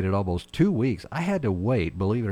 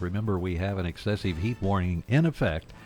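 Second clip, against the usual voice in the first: normal talking voice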